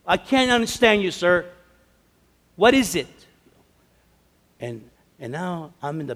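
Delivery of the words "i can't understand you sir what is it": imitating a loud, high, exasperated clerk; mock irritated outburst, raised voice; nasty. Girl getting nasty with an old man, loudly impatient. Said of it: "she is getting nasty with this man".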